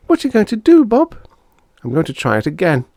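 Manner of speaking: put-on very high eager kid voices; children plotting. Siblings talking, high voices.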